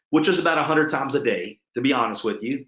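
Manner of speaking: ordinary delivery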